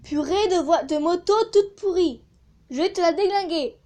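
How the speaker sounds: fuming at the noisy motorbike; annoyed. Real, not acted. Vlogger cursing the motorbike ruining her recording, real annoyance. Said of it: "je suis vénère".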